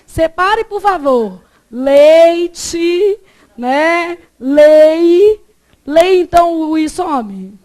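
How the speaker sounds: loud, over-enunciated challenge to a teacher; hammed-up exasperation, punching each example; mock challenge. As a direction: fire off the test words with big drawn-out vowels.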